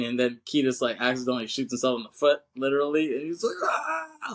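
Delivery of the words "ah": mock scream. [name] shot himself in the foot, mock scream. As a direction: let out a put-on shriek. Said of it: "Like, he's screaming".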